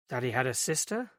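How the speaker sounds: ordinary delivery, nothing marked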